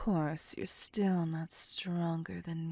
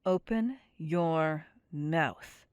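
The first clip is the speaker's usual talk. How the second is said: louder, slow insistent order; forceful command; 5 dB louder, slower than usual. Acted. Vampire ordering her chained captive to open his mouth, forceful. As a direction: make it a demand now.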